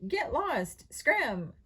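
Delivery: high, loud parrot squawks. A narrator's reading. Parrot chasing off visiting Girl Scouts, high squawk. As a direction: screech it like a bossy bird. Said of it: "the parrot screeched".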